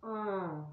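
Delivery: a soft held nasal um for the tilde sound; nasal sound demo. Shown as a demonstration. Teacher models the nasal sound for pupils, softly. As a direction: say um as the nasal vowel the tilde makes. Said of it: "passa a ser".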